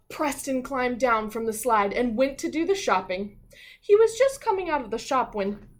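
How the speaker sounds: storybook narration, building to suddenly; story reading. A narrator's reading. Teacher reading the class a story, with a suspenseful pause. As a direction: read it like a picture book for kids.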